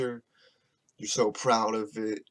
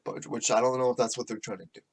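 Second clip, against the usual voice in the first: ordinary delivery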